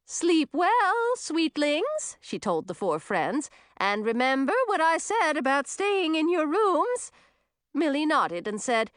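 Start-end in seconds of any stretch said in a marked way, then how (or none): 0.0-2.2 put-on high, sugary old witch; sing-song witchy good night; sweet witch voice. Witch bids guests good night. 3.8-7.1 put-on high, sugary old witch; sing-song witchy good night; sweet witch voice. Witch warns guests.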